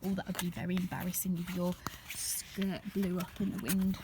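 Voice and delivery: soft, quick whisper; confiding whisper. A narrator's reading. Telling a child an aside, whispered. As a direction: share a little secret.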